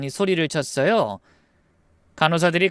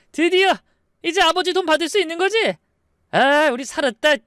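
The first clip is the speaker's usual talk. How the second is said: very high, jubilant outburst; excited; 11 semitones higher than usual. Acted. Second brother expecting father's money, excited. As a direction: exclaim in high-pitched greedy elation. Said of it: "흥분했죠".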